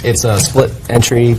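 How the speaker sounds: ordinary talk, nothing marked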